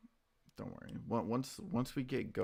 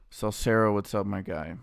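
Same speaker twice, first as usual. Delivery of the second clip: booming shout of welcome; loud hey to a chat regular; loud greeting; 14 dB louder than usual. Real, not acted. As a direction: call out the name big and happy.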